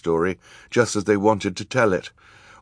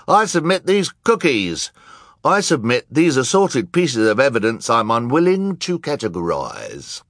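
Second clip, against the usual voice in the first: a rapid-fire parade of voices, many high and loud; loud, insistent courtroom declarations; clamouring evidence submitters; 7 semitones higher, 5 dB louder than usual. A narrator's reading. Crowd of witnesses submitting evidence.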